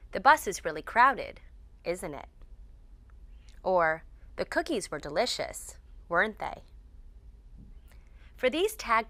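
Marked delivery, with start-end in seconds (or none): 0.1-2.3 small-talk comment, "isn't it" going down; downward intonation. Shown as a demonstration. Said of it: "We use downward intonation". 4.4-6.6 "weren't they" falling at the end; small-talk comment, "weren't they" going down; downward intonation. Shown as a demonstration. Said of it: "We use downward intonation".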